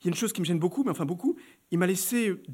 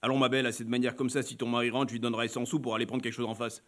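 low, unbothered man's voice; calm man; 8 semitones lower, 6 dB quieter than usual. Acted. Client calmly dismissing the wife's fears, low and easy. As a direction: let it roll out coolly, almost bored. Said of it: "il me répond tranquillement".

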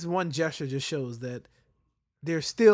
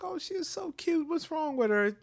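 much higher, cooing stranger; sweet old lady; 12 semitones higher than usual. Retold or imitated. Father imitating a stranger cooing over his daughter, much higher. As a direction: coo over the child like a kind lady. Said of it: "She's like".

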